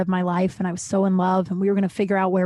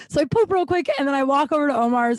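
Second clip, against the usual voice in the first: ordinary talk, nothing marked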